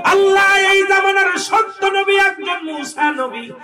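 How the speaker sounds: high, loud chanted plea to God; impassioned, drawn-out supplication; crying out in prayer. Acted. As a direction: wail the prayer out at high pitch. Preacher voices mother's prayer.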